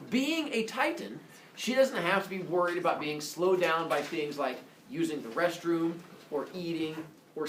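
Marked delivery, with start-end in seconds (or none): none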